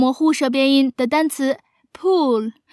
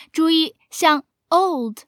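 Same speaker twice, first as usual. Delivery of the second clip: ordinary talk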